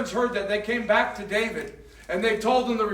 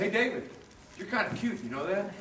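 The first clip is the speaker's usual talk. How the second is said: flirty; 4 semitones lower, 10 dB quieter, faster than usual. Acted. Abigail flirts with David.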